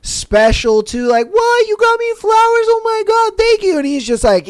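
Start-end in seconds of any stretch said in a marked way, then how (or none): 1.3-3.8 high, squealing mock delight over flowers; gushing girlfriend. Girlfriend thanking him for flowers, high mock squeal.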